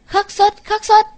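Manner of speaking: ringing spell, said with full authority; commanding incantation. A narrator's reading. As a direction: call out the spell with triumph. Magic incantation at climax.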